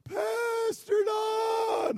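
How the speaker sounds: high, drawn-out shout of the name; hollering across lot. Retold or imitated. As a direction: call out from across the parking lot. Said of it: "I remember hearing him holler".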